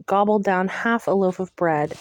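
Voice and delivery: plain reading voice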